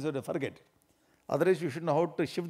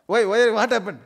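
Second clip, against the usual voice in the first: loud, high worried call; loud alarmed question; 5 semitones higher, 9 dB louder than usual. Acted. Husband to his crying wife, loud alarmed question. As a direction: call out what happened, loudly.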